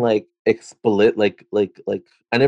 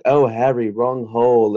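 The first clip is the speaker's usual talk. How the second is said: put-on British accent. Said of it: "my British accent".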